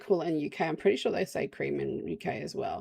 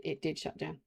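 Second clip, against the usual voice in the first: normal talking voice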